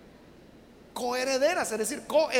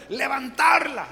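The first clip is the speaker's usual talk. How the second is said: louder, shouted high word; preached shout; 3 semitones higher, 6 dB louder, slower than usual. Real, not acted. Preacher exalting women before the congregation, shouting.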